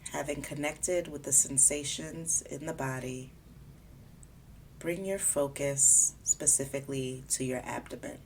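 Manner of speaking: calm, unhurried body scan guidance; guided meditation. Acted. Guiding a listener through a body scan, calm meditation voice. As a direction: ease the listener gently from part to part.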